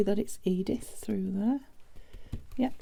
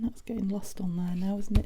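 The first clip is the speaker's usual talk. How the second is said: usual speaking voice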